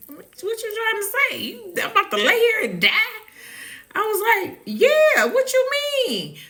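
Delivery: high startled what-do-you-mean; incredulous. Retold or imitated. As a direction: squeal in disbelief. Incredulous reply to paramedic.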